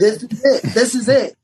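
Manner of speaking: laughing through the words; chuckling as he repeats it. Real, not acted. Telling friends about his find, laughing. Excited over finding the family.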